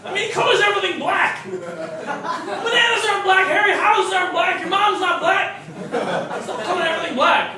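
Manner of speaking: hammed-up teacher scolding a child; mock scolding. Scolding Harry directly.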